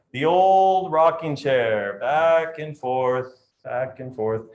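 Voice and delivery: slow, swaying rocking-chair delivery; drawn out like a rocking chair. Shown as a demonstration. Teacher showing the rocking chair type, slowly. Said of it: "take their time".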